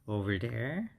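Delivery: ordinary talk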